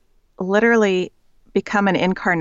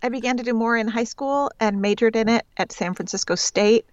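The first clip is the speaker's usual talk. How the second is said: usual speaking voice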